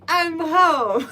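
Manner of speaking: high, cute little homecoming call. Retold or imitated. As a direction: sing out I'm home sweetly, with a laugh. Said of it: "He would do it in this cute voice".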